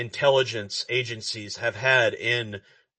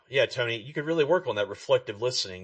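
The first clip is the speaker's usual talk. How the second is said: ordinary talk, nothing marked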